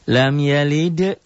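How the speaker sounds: slow, high melodic tartil chant; Quran recitation. Shown as a demonstration. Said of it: "teacher recites Quran verse". (lantunan tartil pelan)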